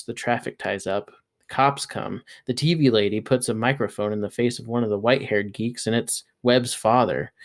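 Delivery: ordinary delivery, nothing marked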